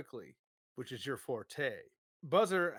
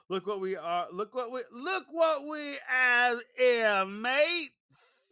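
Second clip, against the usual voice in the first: gleeful Dreadnok thug, higher and louder, slowing on "have here"; 8 semitones higher, 7 dB louder, slower than usual. Acted. Dreadnok thug crowing at the captured Joes, loud and gleeful. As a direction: crow over the captives.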